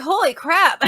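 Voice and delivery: surprised. Retold or imitated. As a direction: blurt it out in disbelief. Reacting to fans praising [name].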